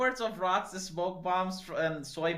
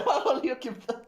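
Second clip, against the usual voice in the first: higher, louder, through a laugh; laughing question; 6 semitones higher, 4 dB louder than usual. Real, not acted. Friend laughing at the ukip boast, laughing.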